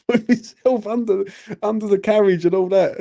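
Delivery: high-pitched, laughing as you talk; real amused laugh. Real, not acted. Man recalling hiding from revenue officers, laughing. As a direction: crack up partway into telling it.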